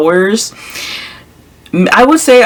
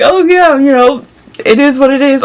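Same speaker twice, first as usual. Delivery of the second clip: high, giggly, shrugging it off; amused, laughing it off; 8 semitones higher than usual. Retold or imitated. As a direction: laugh through the words, light and carefree. Laughing off husband's needle remark.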